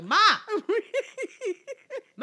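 calling mom from the next aisle. Retold or imitated. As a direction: holler for mom between laughs. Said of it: "call you in the middle of the store".

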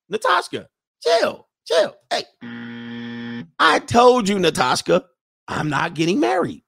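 high, loud retold protest; exasperated outburst. Acted interruption of girlfriend's wedding talk.

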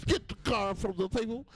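high, mumbly put-on officer voice; Bill Cosby caricature. Comedian runs with the Cosby cop joke, high caricature. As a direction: play the cop as a rambling cartoon figure. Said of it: "Officer Bill Cosby".

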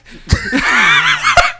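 shrieking burst of laughter; loud laugh. Real, not acted. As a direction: crack up loudly. Laughing hard.

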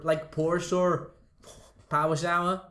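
slow, high mock Irish "pour sure". Teasing friends with a mock Irish "power shower". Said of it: "do some Irish accent".

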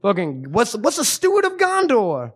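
high, put-on voice ranting about wizards; mock his friend's stoned rant in a squeaky voice; impression of stoned Jesse. Host mocking his stoned cohost's film snobbery, high put-on rant. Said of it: "you do a wonderful impression of me".

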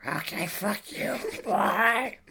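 slow, soft gravelly sign-off; weird noisy croak. Acted. Snubbed caller hanging up on the host, slow croak. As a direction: croak it out like a barely intelligible noise. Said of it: "It just sounded like a weird noise".

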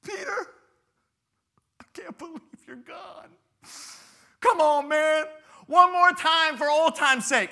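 high, mock-mournful friend; hammed-up mourning buddy; grieving buddy. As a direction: plead to the dead friend. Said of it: "he's like".

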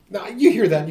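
usual speaking voice

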